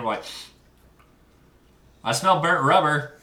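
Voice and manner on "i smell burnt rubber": raised, higher mock-indignant holler; mock outraged callout. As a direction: call it out loudly to the whole shop. Imitates group's reaction on walking in.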